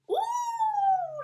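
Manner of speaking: high delighted squeal of ooh; thrilled at the pretty dress; excited ooh. Acted. Lady Wallopy spots a pink dress, excited cry. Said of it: "she cried excitedly".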